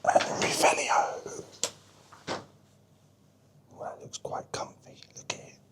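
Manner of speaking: hushed whisper so sleepers aren't woken. Real, not acted. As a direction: keep it to a soft breathy whisper. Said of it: "I'm whispering because people might be asleep".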